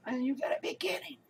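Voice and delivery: high, disbelieving whisper; hushed outrage at the doctor; incredulous whisper. Real, not acted. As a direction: breathe it out in shock. Disbelief at sending him home.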